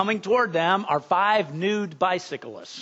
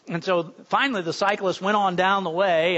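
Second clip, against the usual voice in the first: usual speaking voice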